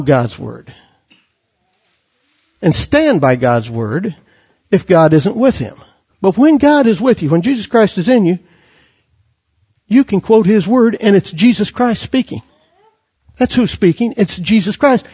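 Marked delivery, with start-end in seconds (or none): none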